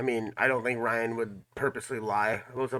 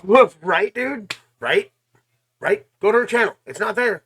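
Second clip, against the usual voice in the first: much higher, louder outraged call; real exasperated outburst; indignant; 7 semitones higher, 6 dB louder than usual. Real, not acted. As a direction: demand answers from the audience, loud. Indignant rant to viewers.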